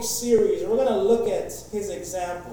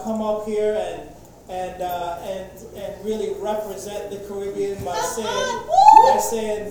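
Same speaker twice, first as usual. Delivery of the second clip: ordinary talk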